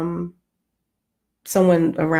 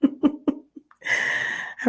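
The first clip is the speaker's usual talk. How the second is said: slow words through a real laugh; 7 semitones higher, 3 dB quieter than usual. Real, not acted. Signing off to viewers, laughing about cupcakes.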